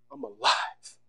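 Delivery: breathless whispered "I'm alive". Acted. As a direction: breathe it, awed and hushed. Foreman's quiet realization.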